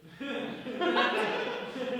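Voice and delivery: a long theatrical last gasp; dying gasp as Prince Philip. Retold or imitated. For the class, Prince Philip's dying gasp. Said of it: "which is Prince Philip".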